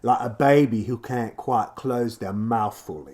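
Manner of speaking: slow, loud, slack-mouthed British accent; British vowels as if the mouth won't close; slurred British accent. Shown as a demonstration. Explaining the accent while doing it, slurred British. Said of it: "where you can barely talk".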